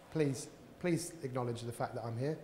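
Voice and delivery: soft, low hinted plea; quiet plea. Retold or imitated. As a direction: beg quietly to be noticed. Imagined plea of ignored person.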